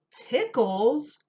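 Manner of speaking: high, loud puzzled echo; puzzled cry. A narrator's reading. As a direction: repeat it in surprise. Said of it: "cried the townspeople".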